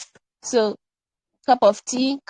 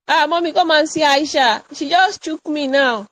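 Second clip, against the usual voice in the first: put-on higher-pitched child complaining to mommy; child tattling; 5 semitones higher, 4 dB louder than usual. Child telling mommy about Aisha, tattling. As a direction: call out an indignant tattletale's complaint.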